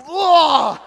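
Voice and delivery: loud imitated barfing noise; mock retching blah. Girl retching at the sight of the loogie, loud mock vomit sound. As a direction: make a loud puking sound.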